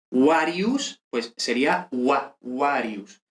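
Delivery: "Warius" said WA-ri-us; English w opens "Warius". Shown as a demonstration. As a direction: say "Warius" with rounded w, no v. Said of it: "la V suena como W".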